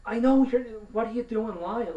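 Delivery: high, loud exasperation; indignant outburst, voice jumping up; outraged. Real, not acted. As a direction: burst out at the liar. Ranting at agent for lying.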